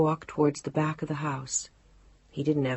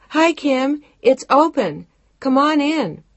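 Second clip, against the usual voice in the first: high, loud call from another room; 10 semitones higher, 7 dB louder, slower than usual. A narrator's reading. Woman calls from the back of the house, high and loud. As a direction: call out brightly to the visitor at the door. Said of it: "her voice rang out from the back".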